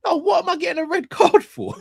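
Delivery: high-pitched, indignant squeal; squeaky, hammed-up outrage; mock protest. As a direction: protest the red card in a very high voice. Mock protest at being penalised on the show.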